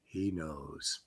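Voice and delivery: low, very soft gravity; Spock. Acted. Spock answering the doctor about Jim, low and solemn. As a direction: drop the voice deep and hushed. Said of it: "And Spock goes".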